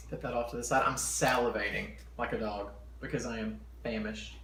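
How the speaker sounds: ordinary delivery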